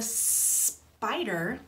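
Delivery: SPIDER with a long hissed S; slow down on the S of spider; drawn-out snake sound. Shown as a demonstration. Storyteller flags a word for children, drawn-out S. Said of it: "let's say spider again".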